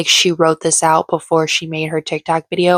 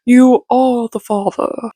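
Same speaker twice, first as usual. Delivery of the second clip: slow, higher, drawn-out talk-show reveal; Maury quote; 5 semitones higher, 3 dB louder, slower than usual. Retold or imitated. Vlogger quotes Maury's paternity reveal, slow and higher, a light Maury impression. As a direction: announce it like Maury on his show. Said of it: "they start quoting Maury".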